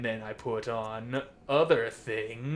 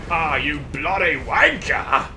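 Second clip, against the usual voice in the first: mock British curse; 2 semitones higher, 6 dB louder than usual. Yelling at boss, British insult.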